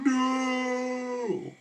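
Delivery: sudden high shout of despair; mock melodramatic outburst, way overdone; dramatic "No!". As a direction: cry it out like a TV detective losing everything. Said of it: "the detective's like".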